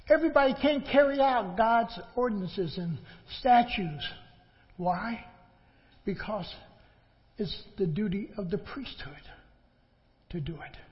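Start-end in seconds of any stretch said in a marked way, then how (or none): none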